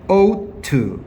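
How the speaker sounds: "ought to" spaced out and high-pitched on "ought"; "ought to" as two clear falling beats; pronunciation model. Shown as a demonstration. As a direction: model "ought to" for learners, long first vowel. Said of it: "얘는 발음 어떻게 해야 되냐면".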